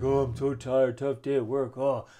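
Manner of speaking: husband coming home whining about his tough day; tired husband. Retold or imitated. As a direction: play the worn-out husband home from work. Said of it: "I know you're coming home like".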